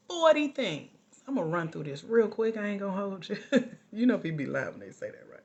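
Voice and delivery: usual speaking voice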